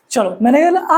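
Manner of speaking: ordinary delivery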